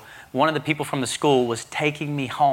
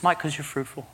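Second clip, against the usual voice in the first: lightly voiced God; 4 dB quieter than usual. God's voice in retold vision.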